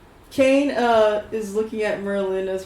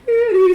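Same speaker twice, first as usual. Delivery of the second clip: higher, louder sung squeal; higher, louder karaoke squeal; 10 semitones higher, 9 dB louder than usual. Retold or imitated. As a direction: hit a bad high note. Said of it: "he's like".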